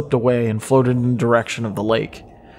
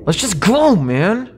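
scared, pitched-up plea to leave; frightened friend urging escape; urgent pressing; 8 semitones higher, slower than usual. Acted. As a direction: press him to go now. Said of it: "Josh pressed".

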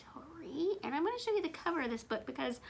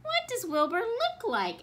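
curious character voice; 9 semitones higher, 4 dB louder than usual. A narrator's reading. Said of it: "asked an elephant".

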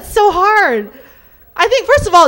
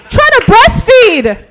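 high, loud, slow put-on voice; mock breastfeeding mom; 9 semitones higher, 6 dB louder, slower than usual. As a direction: ham up a frantic mother.

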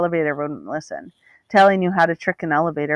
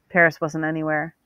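usual speaking voice

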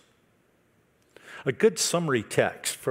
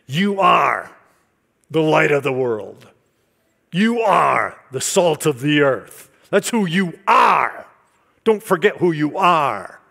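loud, high preaching cadence; 3 semitones higher, 10 dB louder, slower than usual. Retold or imitated. Retelling the guest pastor's sermon, loud preaching. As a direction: proclaim it to the congregation. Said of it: "And when he was preaching".

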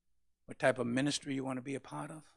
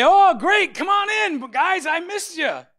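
high, loud, slow sarcastic welcome; sing-song mock "come on in"; mock welcoming Jesus; 17 dB louder, slower than usual. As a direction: ham up the cosy greeting he never gave. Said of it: "Jesus didn't say".

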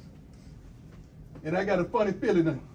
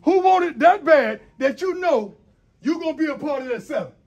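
rising, louder call to the team; pep speech; 4 semitones higher, 7 dB louder than usual. Real, not acted. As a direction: fire the players up, building. Coach fires up team.

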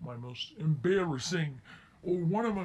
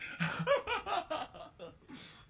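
high, breathy imitated giggle; imitating the kids' high giggling; mock kids' laugh; 14 semitones higher than usual. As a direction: giggle like the mocking kids. Kids laughing retold.

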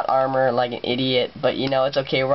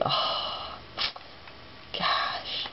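whispering; 10 dB quieter than usual. Real, not acted. Awed by lava.

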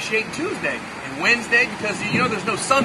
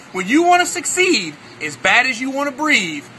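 guru's lesson said with slow weight; emphatic guru; 3 semitones higher, 4 dB louder than usual. Retold or imitated. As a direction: drive the lesson home.

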